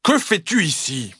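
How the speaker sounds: gruff papa bear demand; roaring big voice. Acted. Papa bear questioning the fox, big roaring voice. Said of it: "rugit-il de sa grosse voix".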